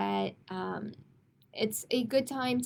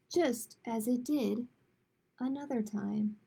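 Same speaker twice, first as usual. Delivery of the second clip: lilting, sing-song storybook narration; picture book reading; 3 semitones higher than usual. A narrator's reading. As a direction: read the rhyme aloud to children, gently. Reads a rhyming picture book.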